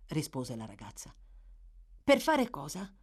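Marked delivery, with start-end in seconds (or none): 2.0-2.9 a quick, startled asking; startled question. A narrator's reading. Boy startled by Nancy's order, startled question. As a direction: jump at the idea and ask. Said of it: "domandò lui trasalendo".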